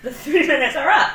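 loud announcement through laughter; laughing. Real, not acted. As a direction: call time while laughing. Laughing, cutting the friend off.

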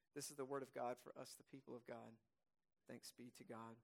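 low, hushed close to a reading; quiet, settled words of thanks; liturgical response. Real, not acted. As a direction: end the reading softly and reverently.

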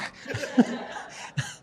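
a real, airy laugh with no voice; breathy laugh. Real, not acted. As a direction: laugh under your breath in disbelief. Laughing after "what is in my truck.

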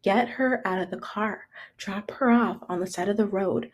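hushed, controlled mock menace; cold roommate demand. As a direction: imitate an icy order under the breath. Roommate's cold demand to eject her.

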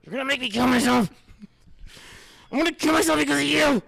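high, loud mock meltdown; hammed-up screaming. Host blaming co-host for his misery, hammed-up screaming. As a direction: scream it as an over-the-top threat. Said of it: "He's just screaming in my gut".

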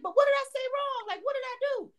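high, indignant what-did-I-say; a retold huffy protest, up high; mock indignant. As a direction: play innocent and offended, pitched high. Her own indignant retort.

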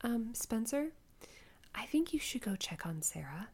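imitating mom's careful near-whisper; softly, tentatively sending someone to check; hushed concerned mom. Mom quietly alerting Spencer.

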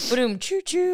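high rimshot vocal effect; drum rim-shot noise. Pretend. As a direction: make a ba-da-choo rimshot sound. Joke sound effect.

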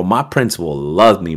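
ordinary delivery

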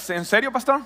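lightly voiced skeptical church member; a hint of mock disbelief; mock doubtful listener. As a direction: play a listener half-amazed at the pastor's claim. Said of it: "Algunos ahorita".

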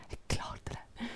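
hushed whisper to herself. Retold or imitated. Artist quietly telling herself she made it, whispered. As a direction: breathe it out to yourself. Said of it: "Drikk en kopp kaffe og bare".

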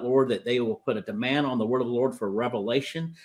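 normal talking voice

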